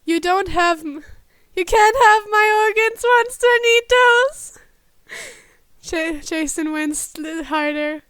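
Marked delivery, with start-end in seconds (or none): 0.0-4.6 high, loud panicked protest; panicked yelp. Acted. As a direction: shriek it out in a panic.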